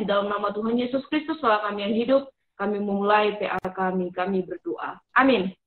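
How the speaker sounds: earnest spoken prayer. Acted. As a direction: pray aloud, sincere and measured. Opening prayer for the session. (doa yang khusyuk)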